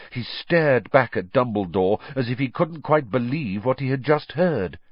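usual speaking voice

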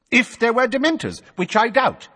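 Fudge-type bureaucrat scoffing; scornful minister. A narrator's reading. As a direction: sneer at the claim.

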